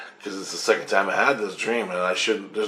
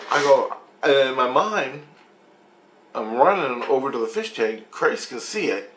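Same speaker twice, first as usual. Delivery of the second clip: normal talking voice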